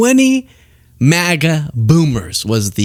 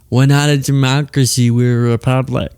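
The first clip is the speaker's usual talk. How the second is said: mock smug quote. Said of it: "bothers me".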